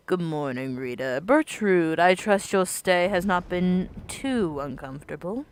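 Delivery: low, soft servant voice; a courteous host; polite innkeeper. Acted.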